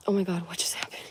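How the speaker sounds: stunned hushed whisper; shocked whisper. Real, not acted. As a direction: whisper it in disbelief. Shocked aside at chat.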